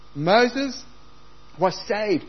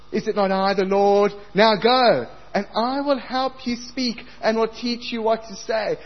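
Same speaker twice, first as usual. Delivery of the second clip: God challenging; 7 semitones higher, 4 dB louder than usual. Acted. Preacher voicing God's challenge.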